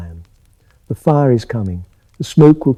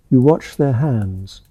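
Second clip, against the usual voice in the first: usual speaking voice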